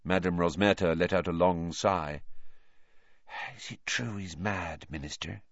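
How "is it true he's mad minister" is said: quiet. A narrator's reading. Rosmerta quietly asks.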